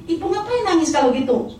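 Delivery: high, loud exasperated challenge; imitated angry driver. Taxi driver scolding the crying passenger, angry. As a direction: mimic someone angrily demanding an explanation. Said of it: "Dia marah lagi".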